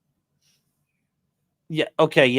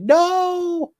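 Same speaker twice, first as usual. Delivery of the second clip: high despairing no. Retold or imitated. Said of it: "And he's like".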